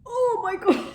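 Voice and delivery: high, loud shocked gasp of oh my God; catching her own reflection and recoiling; shocked yelp. Real, not acted. Shocked cry at her own makeup, high and loud.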